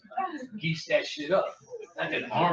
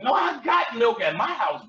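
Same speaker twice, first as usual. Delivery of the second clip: usual speaking voice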